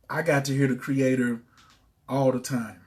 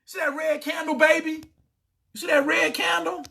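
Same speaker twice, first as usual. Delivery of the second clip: much higher giddy voice; excited; 11 semitones higher than usual. Real, not acted. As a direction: show off the win. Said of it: "I just got excited".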